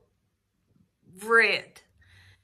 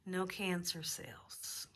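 hushed whisper of relief; slow relieved whisper; 3 semitones lower, 13 dB quieter, slower than usual. Real, not acted. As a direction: breathe it out in awe. Reveals good test news.

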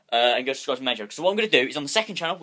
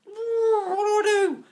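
whiny despair; much slower than usual. Real, not acted. Exasperated whine, high, far.

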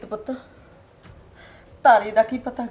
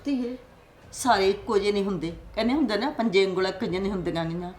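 much softer, hushed reassurance; soft consoling; 4 semitones lower, 11 dB quieter, faster than usual. Acted. Mother quietly reassuring daughter-in-law that not all men are bad. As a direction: coax her with a quiet proverb.

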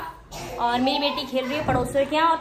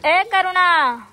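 higher, stretched summons; calling out; 4 semitones higher, 6 dB louder, much slower than usual. Real, not acted. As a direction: holler slowly to your daughter. Calling out to daughter.

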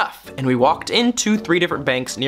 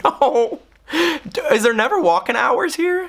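higher, incredulous complaint; exasperated; 9 semitones higher than usual. Retold or imitated. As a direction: unable to believe it. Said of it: "And I'm like".

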